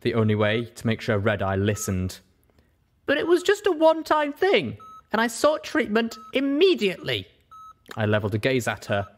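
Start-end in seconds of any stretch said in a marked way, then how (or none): 3.1-7.3 put-on high, firm young pony voice; Little Pip, defensive. Little Pip defends herself to Velvet, high and firm. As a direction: defend yourself steadily. Pip's character voice.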